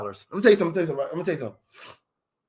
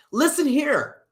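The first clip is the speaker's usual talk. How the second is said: high, mock-scolding drawl on "Listen here"; stretched words; 8 semitones higher, 4 dB louder, slower than usual. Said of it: "Always stretch your words".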